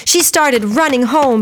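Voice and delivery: usual speaking voice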